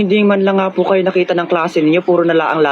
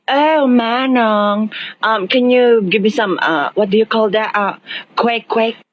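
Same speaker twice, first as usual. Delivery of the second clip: put-on conyo English accent; 3 semitones higher, much slower than usual. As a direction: drawl it like a rich conyo kid, in a conyo English accent.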